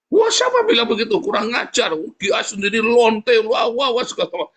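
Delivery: high, loud hammed-up retort to an insult; mock indignant outburst. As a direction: act out someone furiously hitting back. Acted indignant reply to insults. (pura-pura marah membalas makian, bernada tinggi)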